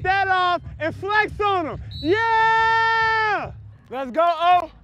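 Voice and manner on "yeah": high, loud hype yell. Real, not acted. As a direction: let it rip to fire up the team. Flexing for the team.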